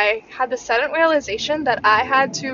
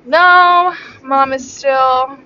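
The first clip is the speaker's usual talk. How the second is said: emphatic no; 3 semitones higher, 8 dB louder, much slower than usual. Retold or imitated. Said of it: "And I say".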